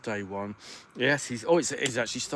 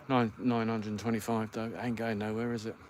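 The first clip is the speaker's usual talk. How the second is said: ordinary talk, nothing marked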